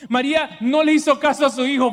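normal talking voice

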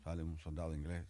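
normal talking voice

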